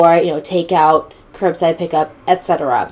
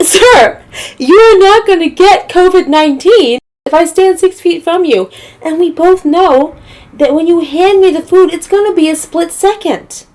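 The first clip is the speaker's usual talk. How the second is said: high, loud, incredulous lecture; pitched-up exasperated argument with the worker; exasperated retort; 8 semitones higher, 4 dB louder than usual. Retold or imitated. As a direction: talk down to him in disbelief. Arguing with the Chick-fil-A worker.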